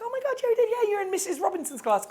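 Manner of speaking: high, put-on girly voice; guy acting out a girl, squealing with delight at a reunion; girl's voice. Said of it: "She's like".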